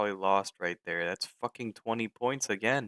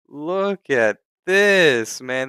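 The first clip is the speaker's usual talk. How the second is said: a slow, loud shout, much higher; furious shout; 4 semitones higher, 9 dB louder, much slower than usual. Real, not acted. Trader yelling at his crashing chart, slow furious shout. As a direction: yell it out, drawn out in disbelief. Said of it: "I'm getting pissed off now".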